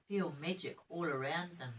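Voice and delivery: plain reading voice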